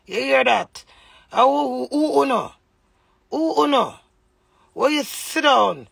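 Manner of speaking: loud, high indignant questions; heated shouting. Real, not acted. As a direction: fire the questions at him, outraged. Heated rant peak.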